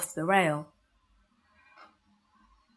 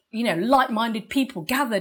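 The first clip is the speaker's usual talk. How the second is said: ordinary delivery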